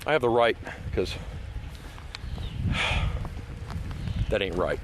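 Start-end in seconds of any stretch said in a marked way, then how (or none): 1.2-4.2 long, deflated breath out; weary sigh. Real, not acted. Vlogger ranting at poachers, weary sigh. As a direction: sigh out the frustration. Frustrated pause while ranting.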